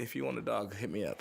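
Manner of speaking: normal talking voice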